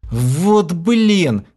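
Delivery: howled at full voice; howling. A narrator's reading. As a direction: wail it out at the top. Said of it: "взвыл я во весь голос".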